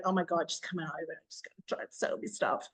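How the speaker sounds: quick, hushed mocking aside; mocking whisper. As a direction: whisper it like a gossiping onlooker. Mocking what people say.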